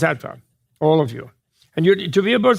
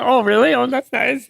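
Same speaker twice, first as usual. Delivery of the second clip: a hint of a child's voice, a bit higher; lightly voiced little kids; 7 semitones higher than usual. Little kids answering His Holiness, in a lightly childlike voice. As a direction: mimic small children reacting sweetly.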